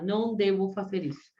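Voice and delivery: usual speaking voice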